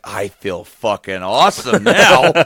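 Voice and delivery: loud, drawn-out euphoric declaration; slow and loud, overjoyed; stoned elation. Retold or imitated. Stoned, answering his partner.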